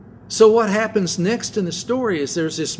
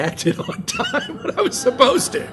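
real laugh breaking through the words; high, laughing self-mockery. Real, not acted. Pastor admitting to his congregation he rarely acts on time, laughing.

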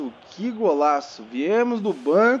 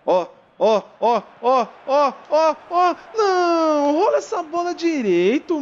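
rising excitement; 9 semitones higher, slower than usual. Real, not acted. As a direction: build the tension as the ball comes loose. Late chance, rising. (empolgação crescente)

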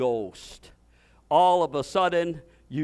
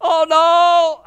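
high, loud hammed-up "Oh, no."; mock dismay; 9 semitones higher, 11 dB louder than usual. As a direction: imitate a frightened believer's cry. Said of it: "I'm not mocking you now".

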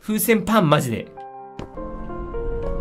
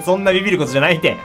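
ordinary delivery

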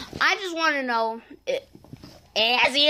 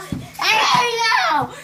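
drawn-out email; 7 semitones higher, much slower than usual. Acted. Drawn-out salesman repeat.

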